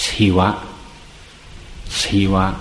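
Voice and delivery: "ศิวะ" with an h in the first sound. Shown as a demonstration. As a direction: breathe an h into the s of "ศิวะ". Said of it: "มันมีตัว H อยู่ด้วย".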